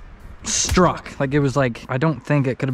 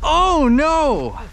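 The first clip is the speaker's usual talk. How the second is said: high, loud alarmed cry; shocked shout; 10 semitones higher, 5 dB louder, much slower than usual. Real, not acted. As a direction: yell in shock as he falls in. Friend falls into the water.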